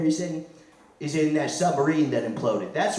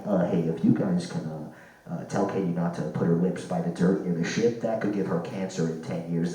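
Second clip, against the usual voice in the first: lower, deadpan radio voice; mock mission control; 9 semitones lower, 4 dB quieter than usual. Comic voicing Mission Control warning the astronauts, low and deadpan. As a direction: play a calm flight controller on the radio. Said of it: "Mission Control being like".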